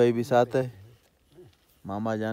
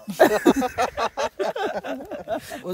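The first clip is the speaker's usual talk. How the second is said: long, high, loud laugh; real helpless cackling; laughing fit; 11 semitones higher, 7 dB louder than usual. Real, not acted. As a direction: burst out laughing at a funny memory. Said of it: "वो हंस रहा है उधर".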